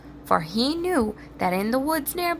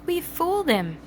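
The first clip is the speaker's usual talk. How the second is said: gleeful; 9 semitones higher than usual. A narrator's reading. Pigs gloating.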